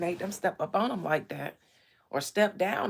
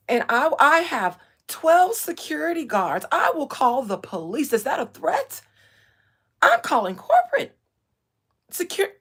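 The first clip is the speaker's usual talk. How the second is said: loud, high mock panic; imitated young streamer; 9 semitones higher, 7 dB louder than usual. Streamer mocking a young man calling for security, indignant. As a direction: mimic a privileged kid threatening with security. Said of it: "and then saying".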